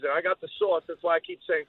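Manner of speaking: normal talking voice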